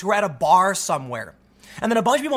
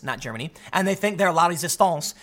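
normal talking voice

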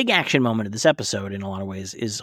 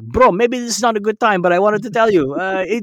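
higher and louder, a bro blurting out thanks; eager bro voice; 8 semitones higher, 6 dB louder than usual. Retold or imitated. Henchman thanking Kate for her advice, eager and loud. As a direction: bro can't wait to share good news. Podcaster voicing bro's thanks.

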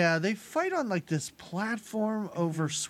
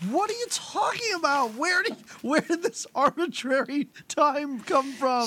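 mock disbelief; 7 semitones higher than usual. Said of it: "I was like".

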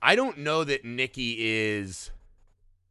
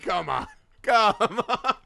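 mock-pleading, second one higher and louder; playful exasperation, rising at the end; exasperated protest; 5 semitones higher, 4 dB louder than usual. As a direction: climb into a pleading complaint. Acted protest at fatso insults.

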